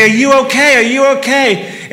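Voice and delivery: loud, worried repeated question; urgent concern. Retold or imitated. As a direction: call out to a crash victim. Calling to crash victim.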